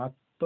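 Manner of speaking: আত্ম as "atto", no ম sound; stressed ত, silent ম. Shown as a demonstration. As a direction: press a double ত in আত্ম. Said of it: "তটা জোর দিয়ে বলা হবে".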